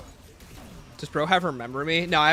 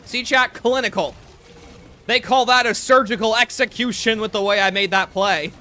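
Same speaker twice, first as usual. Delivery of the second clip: hyped bragging; 3 semitones higher, 6 dB louder than usual. Real, not acted. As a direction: brag to chat like you just clutched. Boasting to chat after a clutch.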